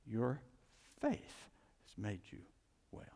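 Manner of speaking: low, soft assurance; a hint of tender comfort; gentle Jesus.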